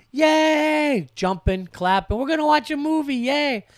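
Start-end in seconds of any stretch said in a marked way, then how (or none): 0.1-1.1 high, loud mock yay; imitated kid's joyful shout; excited kid cheer. Kid cheering at dad's night off. 2.2-3.6 high-pitched imitated child's glee; mock childlike cheering; excited kid. Kid thrilled about movie night with dad.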